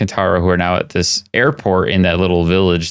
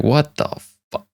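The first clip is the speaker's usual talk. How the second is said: bewildered; 7 semitones higher, 3 dB quieter than usual. Retold or imitated. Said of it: "And he's just like".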